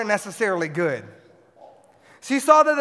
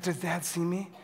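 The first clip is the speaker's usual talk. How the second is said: put-on hushed, sly baby thought; a hint of a sneaky baby; 4 semitones lower, 11 dB quieter than usual. Preacher voicing his baby son sneaking to the cable, hushed. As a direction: peek over like a toddler checking on Dad. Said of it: "He's like".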